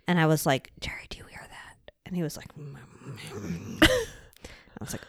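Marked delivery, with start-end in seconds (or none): 0.8-1.9 hushed, re-enacted night-time whisper; very soft, voiceless whisper; frightened whisper. Retelling a scared whisper at night. 2.6-3.8 imitated half-asleep grunts; soft, voiceless sleeper's mumbling; sleepy mumble. Imitates a sleeping partner's mumbled reply.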